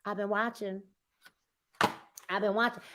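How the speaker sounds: quiet customer quote. Retold or imitated. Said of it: "'Cause they say".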